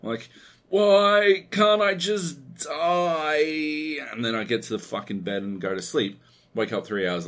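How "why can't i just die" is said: high, very slow, drawn-out mock wail; milk the misery like a dying man; mock despair. Sick man's self-pity, retold.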